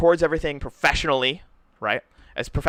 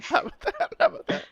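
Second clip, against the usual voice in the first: very high, laughing echo; giggled echo. Real, not acted. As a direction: repeat it while cracking up.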